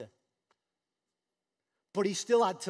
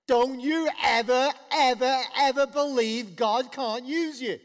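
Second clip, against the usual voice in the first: louder, emphatic repeated ever; stern charge; 6 semitones higher, 9 dB louder than usual. Real, not acted. Preacher urging the church, emphatic. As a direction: drive each ever home. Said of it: "charge to the church".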